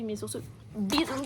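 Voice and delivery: ordinary delivery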